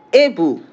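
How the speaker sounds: "able" said the English way, with an "ay" vowel; English pronunciation. Shown as a demonstration. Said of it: "in English".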